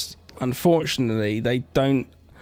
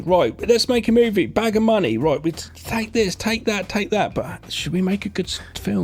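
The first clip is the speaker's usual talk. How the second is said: mock studio exec; 8 semitones higher than usual. Mocking the studio execs.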